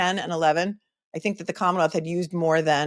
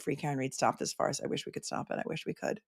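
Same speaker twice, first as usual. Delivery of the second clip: hushed whisper; 4 semitones lower, 13 dB quieter than usual. Real, not acted. Host whispering her wish to end the trial, hushed. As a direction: drop to a breathy whisper, almost no voice. Reading a chat, then a wish.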